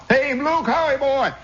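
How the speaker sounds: put-on high-pitched good-old-boy hailing old pals; hammed-up high holler of hello; hollered greetings. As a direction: imitate men calling out across a crowd. Acted regular hailing a friend.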